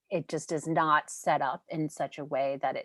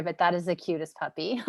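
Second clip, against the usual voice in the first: normal talking voice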